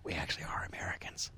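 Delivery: whispered mock confession; barely audible pretend confidential reveal; secretive whisper. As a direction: lean in close and whisper the secret. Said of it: "leaned in real close and told me the secret".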